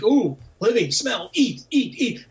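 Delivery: high, eager chant of the hungry dead; mock ravenous ghouls pouncing on each word. Dead people catching the smell of the living, hungry and excited. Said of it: "dead people who are like".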